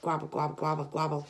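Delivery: lightly voiced turkey gobbling; turkey gobble. Showing kids how a turkey sounds, mild gobble. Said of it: "Big turkey goes".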